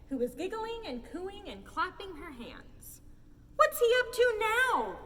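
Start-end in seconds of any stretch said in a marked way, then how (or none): 3.6-5.0 high, loud exasperated cry. A narrator's reading. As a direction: cry out in disbelief. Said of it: "cried the sheriff".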